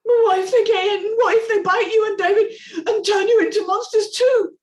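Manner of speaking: high, loud frightened plea; scared pleading. Acted. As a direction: beg Daddy not to go. Amy panicked.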